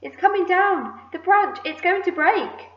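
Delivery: high, loud cry of alarm; shouted alarm. A narrator's reading. Aunt seeing the branch bend, shouting in alarm. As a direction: call out the danger urgently. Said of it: "Aunt Sponge shouted".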